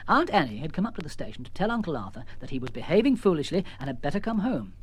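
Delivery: ordinary delivery, nothing marked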